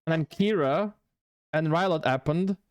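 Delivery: normal talking voice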